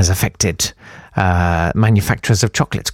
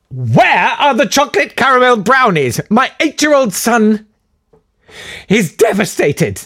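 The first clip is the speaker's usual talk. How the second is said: mock outraged parent, pitched high; complaining customer; 12 semitones higher than usual. Mocking a Quality Street complainer, high whiny outrage. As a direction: whine it like an aggrieved mum.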